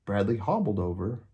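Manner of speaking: ordinary delivery, nothing marked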